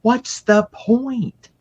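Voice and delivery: high, slow exasperated question. Retold or imitated. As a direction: drag it out, bored with the book. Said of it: "I kept going".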